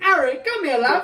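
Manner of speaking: loud, posh-put-on British accent; fake British accent. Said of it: "she puts on a fake accent".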